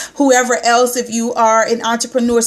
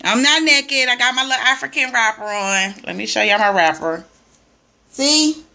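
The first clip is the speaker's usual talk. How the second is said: ordinary talk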